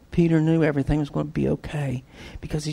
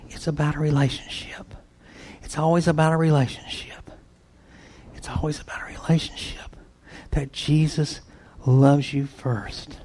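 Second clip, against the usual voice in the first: soft, intimate whisper to the congregation; preacher whispering the heart of the message; 5 dB quieter than usual. Real, not acted. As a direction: lean in and breathe the point. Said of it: "whispered repeated point".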